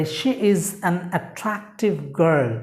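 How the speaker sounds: slow dictation. Shown as a demonstration. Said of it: "যেমন আমরা বলতে পারি".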